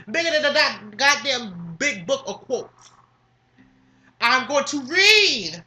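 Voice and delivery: ordinary delivery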